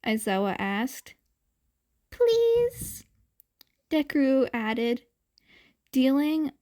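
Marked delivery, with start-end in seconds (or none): 2.1-3.1 cute pleading. A narrator's reading. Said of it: "dialing up the cute factor to eleven".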